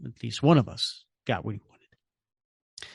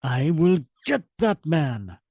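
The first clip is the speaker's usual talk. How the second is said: slow, louder villain threat; vengeful vow; 3 semitones higher, 4 dB louder, slower than usual. Acted. Red Skull vows revenge on the stranger, slow threat. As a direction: grind out a promise of revenge.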